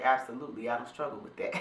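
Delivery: quiet assured answer. Retold or imitated. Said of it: "I would say".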